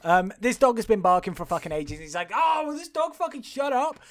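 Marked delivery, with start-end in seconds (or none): 2.3-4.0 much higher, put-on exasperated voice; hammed-up complaint at a noisy dog; mock angry. Man complaining about the barking dog, mock angry. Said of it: "I'm getting angry".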